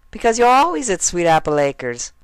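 very high innocent pony voice; sing-song and innocent, way up; Derpy, innocent. Acted. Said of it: "Derpy said, smiling innocently".

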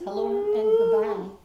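high, loud, slow greeting; sing-song greeting. Real, not acted. Greeting baby with kiss.

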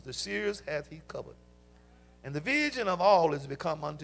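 measured, solemn reading of the verse; formal Bible reading; scripture reading. Real, not acted. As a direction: read it out to the church.

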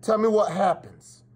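ordinary delivery

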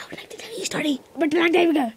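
whisper. Pretend. Kid whispers a story opener.

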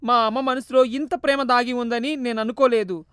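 son breaking down, mild acted tears; understated tearful remorse; regretful weeping. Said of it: "ఏడుస్తూ".

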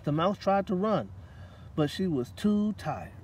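lively storybook narration, drawn out; reading to children. A narrator's reading. Reading a picture book aloud to children at story time. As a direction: read it aloud to a room of kids.

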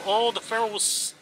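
normal talking voice

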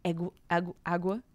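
"Egu-, agu-, agua?" tried out syllable by syllable, questioning; unsure pronunciation. Real, not acted. Reader tries aloud to pronounce ague, unsure. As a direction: fumble for the right sound of ague. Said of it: "I never know how to pronounce that word".

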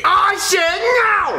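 high, drawn-out scream of refusal; childish tantrum shriek. Acted. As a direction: scream it slowly, digging in. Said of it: "Jeffy, stop screaming".

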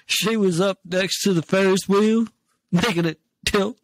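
comic quoting. Retold or imitated. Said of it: "He's like".

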